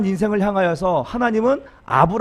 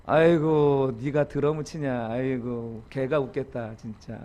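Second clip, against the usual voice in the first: low, soft sneering taunt; mock ridicule; 6 semitones lower, 6 dB quieter, slower than usual. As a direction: scoff quietly at their drumming. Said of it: "뭐 이런 거 조롱하고". (낮고 작게 비웃는 조롱)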